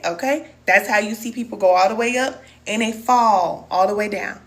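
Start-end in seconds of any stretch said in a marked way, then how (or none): none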